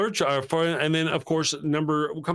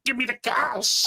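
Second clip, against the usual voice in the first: robber voice; 10 semitones higher than usual. Retold or imitated. Robber with gun in movie.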